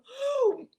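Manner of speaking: sharp loud gasp as the bee goes in; big startled storybook gasp. Acted. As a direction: gasp high and sudden. Bee flies into mouth.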